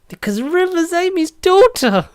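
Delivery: high, slow mock-dramatic reveal. Fan announcing the twist in a high, slow, mock-dramatic voice. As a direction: draw it out high like a big twist.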